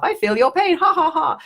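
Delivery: high, bursting into real laughter; laughing. Real, not acted. Empath on a live stream laughing at feeling viewers' pain, laughing high. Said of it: "I'm laughing 'cause at the humor".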